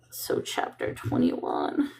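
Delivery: slow whisper while looking it up; whispered to herself. Real, not acted. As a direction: whisper it to yourself as you check the page. Muttering to herself while checking.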